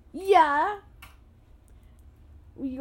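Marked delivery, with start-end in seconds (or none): 0.1-0.8 loud, high rising yeah; pumped yeah. Pretend. Child confirms he is pumped, loud and high. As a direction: shout it out, all pumped up. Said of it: "Are you really pumped?".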